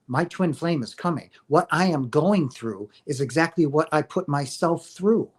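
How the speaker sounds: ordinary delivery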